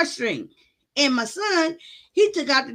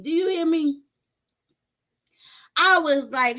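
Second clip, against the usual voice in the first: usual speaking voice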